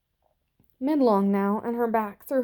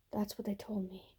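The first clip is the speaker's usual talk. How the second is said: fearful whisper; 13 dB quieter than usual. A narrator's reading. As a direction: drop to a hushed whisper. Frightened girl confessing a secret.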